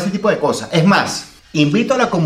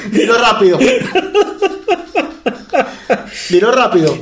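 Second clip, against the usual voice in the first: laughing; 8 semitones higher than usual. Real, not acted. Host daring his partner to say the name fast, laughing. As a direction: laugh out the request.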